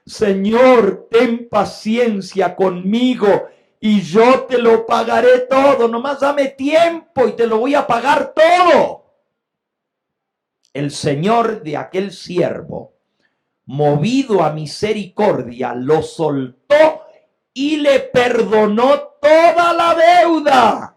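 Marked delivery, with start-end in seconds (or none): 0.1-9.1 loud, high-pitched begging, building to the end; desperate pleading. Acted. Servant pleads with his lord for time to pay, pleading. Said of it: "le suplicaba diciendo". 17.5-20.9 high, loud, drawn-out proclamation; impassioned preaching. Real, not acted. Preacher proclaims the lord's forgiveness, triumphant shout.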